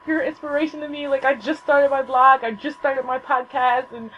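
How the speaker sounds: high, tumbling fangirl confession; acted-out starstruck gush. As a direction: blurt it out, tripping over your words. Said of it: "I'm gushing at this point".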